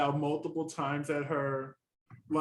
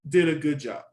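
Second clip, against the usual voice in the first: usual speaking voice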